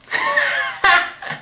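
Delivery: a high, scornful burst of laughter; mocking laugh at the critic. Real, not acted. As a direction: laugh him off. Mocking the critic's long reply.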